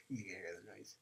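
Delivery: softly whispered, leaning into the mic; real whisper. Real, not acted. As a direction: hush it like sharing a secret. Testing the fidget spinner noise.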